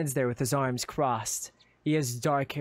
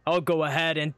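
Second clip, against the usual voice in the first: ordinary delivery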